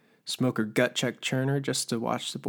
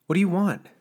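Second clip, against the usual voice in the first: higher, louder demand in rage; angry outburst; 8 semitones higher, 8 dB louder than usual. Acted. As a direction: snap it out, ashamed and furious. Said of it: "a mix of shame and rage".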